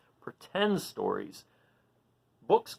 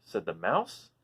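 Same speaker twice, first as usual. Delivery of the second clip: lively, high storybook voice; animated read-aloud for little listeners; reading to children; 8 semitones higher, 5 dB louder than usual. A narrator's reading. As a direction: read it out like a picture book for kids. Reading picture book to children.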